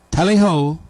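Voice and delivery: a light mock-British call; British accent. Answering how Brits say goodbye, mock British accent. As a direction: toss it off in a put-on British accent. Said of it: "How would you say it in".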